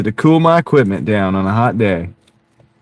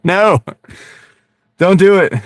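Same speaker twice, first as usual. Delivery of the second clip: pleading no; 9 semitones higher, 3 dB louder than usual. Real, not acted. Begging Crystal not to timestamp.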